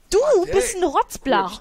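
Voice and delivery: exasperated name-calling at a sim; high mock insult. Real, not acted. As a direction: scold the little brat. Insulting the cake thief.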